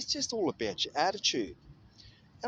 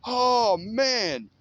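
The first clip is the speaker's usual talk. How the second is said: higher and louder amazed cry; mock thrilled outburst, high and loud; retold amazement; 10 semitones higher, 9 dB louder than usual. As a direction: relive the thrill of hearing the playback. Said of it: "I went".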